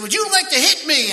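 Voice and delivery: high, loud mocking challenge; taunting yell. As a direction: goad the recruit right in his ear. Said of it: "he said".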